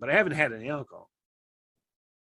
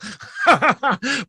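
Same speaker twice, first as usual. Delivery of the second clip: loud, high rising laugh; 10 semitones higher, 8 dB louder than usual. Real, not acted. Laughing at his buddy about the ruined joke, loud high laugh.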